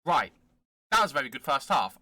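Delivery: ordinary talk, nothing marked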